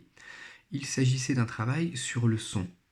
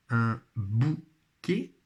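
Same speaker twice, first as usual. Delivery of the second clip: "bouquet" stretched slowly as bou, then quet; syllable by syllable; much slower than usual. Shown as a demonstration. Teacher presenting a picture word, syllable by syllable. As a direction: give "bouquet" in separate beats. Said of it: "dans leur première syllabe".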